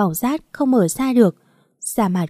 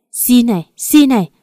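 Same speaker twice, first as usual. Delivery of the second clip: louder, higher, spiteful jabs; angry mocking retort; 3 semitones higher, 6 dB louder than usual. A narrator's reading. Mother-in-law answering daughter-in-law's begging with punishment, angry mocking retort. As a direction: throw the plea back at her angrily. Mother-in-law mocks plea while hurting her.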